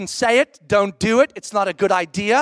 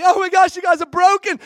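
much higher, louder gasp of shock; mock alarm; 8 semitones higher, 6 dB louder than usual. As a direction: fake horror at a broken couple.

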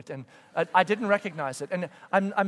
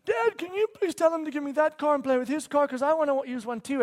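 put-on high, loud little-girl whine; high put-on pleading kid voice; little daughter pleading; 11 semitones higher, 7 dB louder than usual. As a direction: imitate a child appealing to Dad, pitched way up. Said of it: "so my daughter says".

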